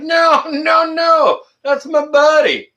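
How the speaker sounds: high, loud, drawn-out no, no, no; hammed-up pleading protest; playful high-pitched defence of a friend; mock high protest. Voicing himself defending his buddy.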